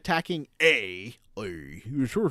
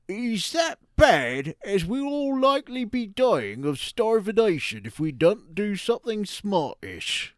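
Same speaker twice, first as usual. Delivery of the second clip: a slow, higher West Country Devon accent; 5 semitones higher, 3 dB louder than usual. Shown as a demonstration. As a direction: read it like a Devon farmer. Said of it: "as if it is a guy from Devon".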